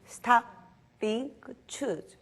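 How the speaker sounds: three steps stated crisply, Stop loudest; emphatic steps. Shown as a demonstration. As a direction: announce each step like a rule. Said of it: "저희 성품학교에서 배운 거예요".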